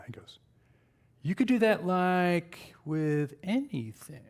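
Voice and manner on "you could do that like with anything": slow, drawn-out puzzled voice, lightly put on; imitating a slow audience guy. Man in the audience slowly puzzles over prototyping.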